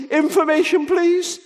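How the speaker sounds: put-on high, eager little boy asking; a small boy reaching out on the phone; child's voice. Nine-year-old boy phones the operator, high childlike voice.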